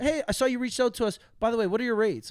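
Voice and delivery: lightly mocking brand rep asking about rates. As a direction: play the eager brand manager asking rates. Brand voice imitated.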